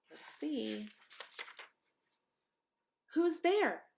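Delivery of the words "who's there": much higher, louder call; little pig calling who's there. A narrator's reading. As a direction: call through the door. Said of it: "called the second little pig".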